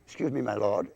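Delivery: ordinary delivery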